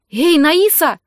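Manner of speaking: loud, high-pitched call; sharp shouted hail to get attention; shouting. A narrator's reading. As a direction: shout it right in the listener's face. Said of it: "прокричала Раиль мне в лицо".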